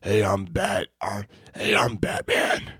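choked, whispery growl; strained growl with something lodged in the throat; bad Batman impression, fighting for air. Shown as a demonstration. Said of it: "sounds like I have something lodged in my throat".

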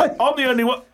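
high laughing ad voice; mock ad guy. Imitating the ad driver, high and laughing. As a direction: play the ad guy, amazed. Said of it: "And he's like".

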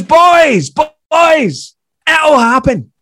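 high, loud long-range call; shouting after them. Retold or imitated. Famous comic shouting after two young comedians as they part, shouting after. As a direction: holler encouragement down the street. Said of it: "he then shouts after us".

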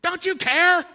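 high, loud whiny plea; mock desperate prayer. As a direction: whine at Jesus over a broken car. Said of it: "We're like".

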